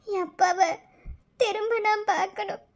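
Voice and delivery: normal talking voice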